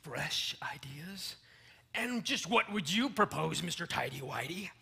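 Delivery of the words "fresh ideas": scandalised whisper. Acted. Scandalised at the suggestion.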